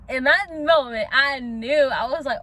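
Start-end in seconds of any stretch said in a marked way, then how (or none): none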